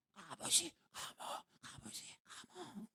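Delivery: arguing in a whisper; whispered quarrel. Retold or imitated. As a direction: fight without being heard. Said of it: "bisik-bisik aja".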